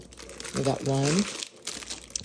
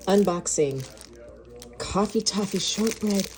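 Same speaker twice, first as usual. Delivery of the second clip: ordinary talk, nothing marked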